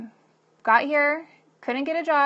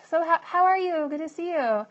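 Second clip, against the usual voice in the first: higher-pitched social pleasantry; mock polite greeting; 6 semitones higher than usual. People greeting the struggling mom, in high-pitched small-talk voice. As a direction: put on a polite hello. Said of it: "people would always be like".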